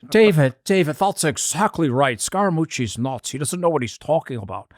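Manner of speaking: playful British accent impression; Dominic Sandbrook. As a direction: tease him in an English accent. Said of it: "He would say it in a better accent".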